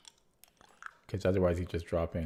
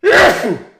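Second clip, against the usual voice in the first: a sudden explosive sneeze; a loud sneeze right into the mic; 20 dB louder than usual. Real, not acted. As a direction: let a real sneeze burst out. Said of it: "I did just shout out with a sneeze".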